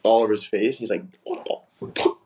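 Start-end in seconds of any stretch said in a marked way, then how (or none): 1.2-2.2 unvoiced, softer tearful squeak; mock crying. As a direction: imitate someone crying in disgusted shock. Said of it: "you guys are both crying".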